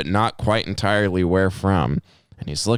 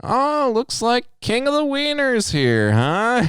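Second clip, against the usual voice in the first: high, slow, drawn-out teasing; mock greeting; slower than usual. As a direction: imitate a customer hailing you with slow ribbing. Customer's repeated teasing greeting.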